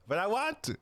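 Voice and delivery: put-on high-pitched childish plea; mock whining. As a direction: plead in a fake tearful whine. Mock pleading against Shady's warning.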